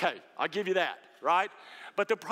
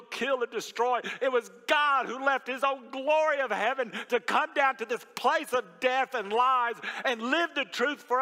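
louder, higher, slow preaching to her; 7 semitones higher, 4 dB louder than usual. Retold or imitated. Pastor telling the grieving mother God is not the enemy, preached. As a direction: build it into a sermon. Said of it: "And I just told her".

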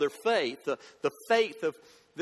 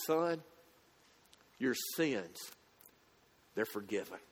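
much softer and lower, near a hush; tender hush; 8 semitones lower, 8 dB quieter than usual. Retold or imitated. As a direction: say "Son, your sins, they're forgiven" like a gentle assurance. Tender retelling of Jesus' words.

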